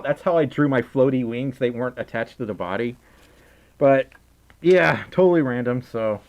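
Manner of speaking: ordinary talk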